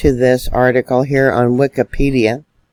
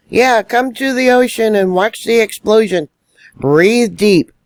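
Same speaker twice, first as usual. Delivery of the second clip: higher-pitched mock encouragement; bitterly mock the tourist pitch, voice pitched up; sarcastic invitation; 7 semitones higher than usual. Vlogger mimicking an invitation to watch the explosion, sarcastic.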